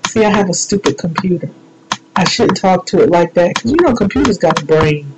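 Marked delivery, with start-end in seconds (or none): none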